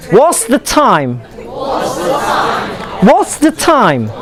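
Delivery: "What's the time?" slow, starting high and falling low. Shown as a demonstration. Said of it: "you go from high to low".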